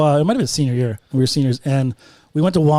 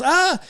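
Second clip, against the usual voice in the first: startled yelp; 11 semitones higher than usual. Retold or imitated. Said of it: "He goes".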